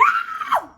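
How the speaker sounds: piercing high, loud scream; loud high-pitched mock shriek; reenacted scream. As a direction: scream out as if dragged away. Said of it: "I'm just screaming".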